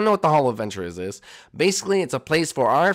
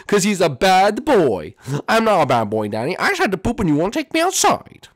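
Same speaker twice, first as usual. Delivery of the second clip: whiny Jeffy voice; 6 semitones higher, 5 dB louder than usual. Acted. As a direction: whine like a naughty child. Jeffy whining at Daddy.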